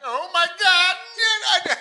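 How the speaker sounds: high, drawn-out scandalized cry; mock shock. Man retelling partner's reaction to his threat, mock shocked. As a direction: imitate a scandalized gasp of disbelief.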